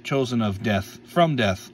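usual speaking voice

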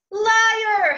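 high, loud furious howl. A narrator's reading. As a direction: howl the accusation. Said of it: "howled I".

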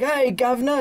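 high, mock British greeting; fake British accent. American girl showing off a picked-up British accent, mockingly imitated. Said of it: "pick up an accent for no reason".

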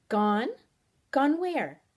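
sharp surprise at bad news; startled questioning. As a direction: question it in alarm. Startled by news companion is gone.

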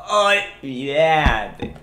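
olvídate stretched very slowly in a put-on voice; very drawn out. Shown as a demonstration. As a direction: drag olvídate out as long as you can. (olvídate estiradísimo, muy lento)

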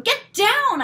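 sharp high loud command. A narrator's reading. Girl ordering the cat off the quilt, loud command. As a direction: bark it at the cat. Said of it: "I commanded".

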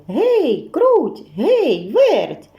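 високий протяжний оклик; поклич друзів голосно; гукає мишенят. A narrator's reading. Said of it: "та й гукає". (півник кличе здалеку, вигукує)

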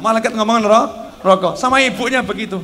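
ordinary delivery

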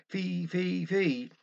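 "Fee, fee, fee" with a lisp standing in for "Fight"; mock lisp on "Fee, fee, fee". Man with a lisp announces the hymn, mock lisp. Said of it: "a peppermint in his speech".

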